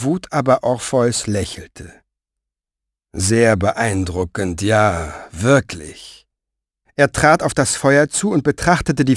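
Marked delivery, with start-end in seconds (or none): 3.1-6.3 lower, slow, sneering; Orpheus's mock praise. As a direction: draw it out like dry applause. Orpheus mocks the fire. (tiefer, langsam, spöttisch gedehnt)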